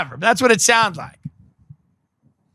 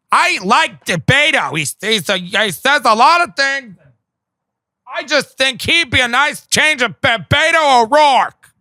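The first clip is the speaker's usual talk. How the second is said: higher airy imitation of a gushing aunt; mock aunt voice; 3 semitones higher than usual. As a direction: parody the aunt gushing over Beto. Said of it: "one of my aunts was like".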